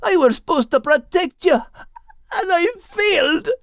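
breaking with grief at the end; anguished. A narrator's reading. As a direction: plead through tears of guilt. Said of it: "Max cried out in anguish".

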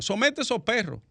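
"perros" spat out as a blunt Dominican insult; "Somete a esos perros" with raw street bluntness; blunt Dominican street talk. Shown as a demonstration. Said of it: "Vamos a decirlo en palabras de buen dominicano".

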